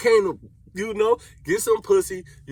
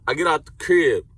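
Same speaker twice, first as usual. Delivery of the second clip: ordinary delivery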